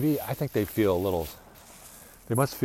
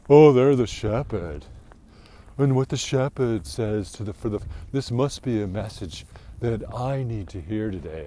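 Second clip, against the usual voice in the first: mock obedient churchgoer; 2 semitones higher, 7 dB louder than usual. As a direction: send up the trusting flock member.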